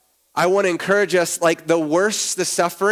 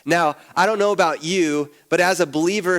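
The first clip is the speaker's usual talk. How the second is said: ordinary delivery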